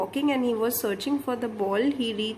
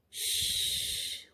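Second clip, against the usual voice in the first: long unvoiced hushing shh; whispered shh; 10 semitones higher, 11 dB quieter than usual. Shown as a demonstration. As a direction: hush like tiptoeing past a sleeping snake. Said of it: "what sound they were making?".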